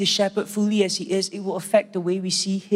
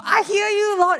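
much higher, gushing awe; high awed gush; 12 semitones higher than usual. Acted. Churchgoers answering the knock, high gushing awe. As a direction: gush up at the Lord.

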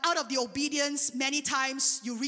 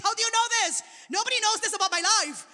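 high-pitched retold astonishment; amazed; 7 semitones higher, 4 dB louder, faster than usual. Imitating woman at the well hearing her secrets, amazed. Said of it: "She's amazed".

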